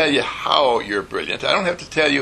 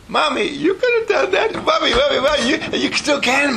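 put-on very high, insistent little-kid plea; hammed-up child tugging at Mommy; begging child; 13 semitones higher than usual.

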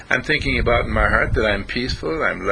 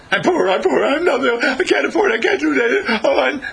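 much higher and louder, fretful whine; anxious whiny thought; 13 semitones higher, 6 dB louder than usual. Pretend. The poverty thought whining inside the student, fretful. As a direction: whimper it like a worried child. Voicing the fearful poor thought.

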